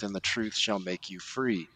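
ordinary talk, nothing marked

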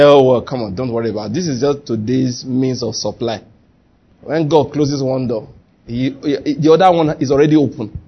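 relaxed low voice, in no hurry; calm reassurance. Acted. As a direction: act out easygoing faith, brushing worry aside. Acted unbothered reply to worried staff.

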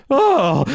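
high theatrical oh before fainting; mock faint groan. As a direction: swoon dramatically. Mule faints on cue.

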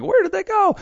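a parent lost at the mall, voice jumping up in mock panic; mild mock panic, very high; panicked parent. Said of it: "you're like".

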